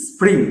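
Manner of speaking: one-syllable স্প্রিং; "spring" with no added vowel in স্প্রিং; correct pronunciation. Shown as a demonstration. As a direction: start স্প্রিং straight on s. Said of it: "সঠিক উচ্চারণগুলো দেখো".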